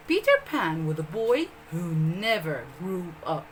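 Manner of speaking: usual speaking voice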